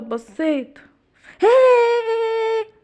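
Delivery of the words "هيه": a sudden loud high "boo"; scare shout. Acted. Ardon jumps out at the giraffe with a loud high yell. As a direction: burst out to startle her.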